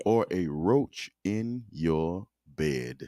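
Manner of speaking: very slow, each word stretched out; slowly. Shown as a demonstration. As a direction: drag it out word by word. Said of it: "Don't say that real fast".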